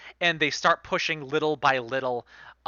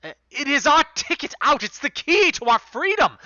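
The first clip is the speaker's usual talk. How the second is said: urgent insistence; 12 semitones higher, 7 dB louder than usual. Acted. NPC insists on the key.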